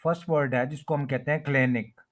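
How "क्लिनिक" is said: क्लिनिक with a long "ay" first vowel, "KLAY-nik"; common mispronunciation. Shown as a demonstration. Teacher showing learners how people commonly say it, mispronounced. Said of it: "mostly इसको क्लिनिक कहा जाता है".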